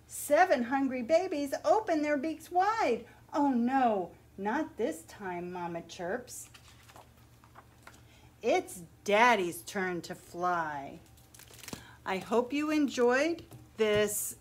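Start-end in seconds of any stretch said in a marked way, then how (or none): 0.1-11.0 put-on lively picture-book read-aloud for children; story narration. A narrator's reading. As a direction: read it like story time at the library.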